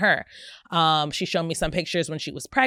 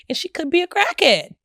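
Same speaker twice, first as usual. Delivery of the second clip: high mock alarm; 11 semitones higher, 4 dB louder than usual. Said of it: "I had some good friends in my ear like".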